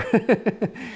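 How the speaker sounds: a quick amused laugh; short laugh. Real, not acted. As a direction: chuckle lightly to yourself. Laughs after his own remark.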